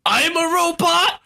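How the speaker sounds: high, slow, shocked question; high surprised voice. Acted. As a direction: squeak it out in shock. Playing the robot girlfriend.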